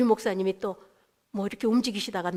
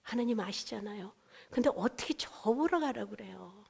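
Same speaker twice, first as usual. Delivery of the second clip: soft plea; 7 dB quieter than usual. Retold or imitated. Soft pleading with God.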